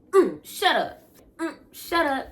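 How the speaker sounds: high, loud mocking shut up; dismissive jab after the punch; sassy dismissal. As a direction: brush him off with sass.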